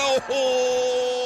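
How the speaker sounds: long stretched excited "oho"; drawn-out excited exclamation. Real, not acted. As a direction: let out a long amazed oho. Goal chance, commentator reacts.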